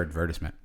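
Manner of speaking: "advertisement" as ad-VER-tis-ment; British pronunciation of "advertisement". Shown as a demonstration. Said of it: "I think it's the British way".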